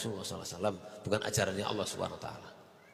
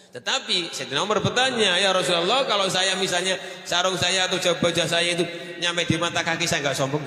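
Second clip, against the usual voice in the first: loud, high, fervent preaching; preaching at full force; 6 semitones higher, 8 dB louder than usual. Acted. Preacher warning the congregation against arrogance, loud and fervent. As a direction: drive the warning home to the crowd.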